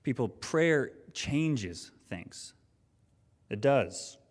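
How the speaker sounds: preaching. Acted. Slow emphatic sermon point.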